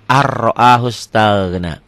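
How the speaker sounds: slow "ar ro'ahu" with doubled R; N left unread. Shown as a demonstration. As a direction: roll "ar" straight into "ro'ahu". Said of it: "warna orennya jangan dibaca".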